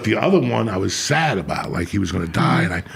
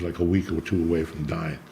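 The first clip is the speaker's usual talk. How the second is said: ordinary talk, nothing marked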